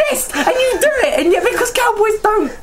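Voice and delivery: high-pitched comic indignation; exasperated rant. Real, not acted. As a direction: argue the point in a squeaky-high protest. Mock quarrel over refusing the accent.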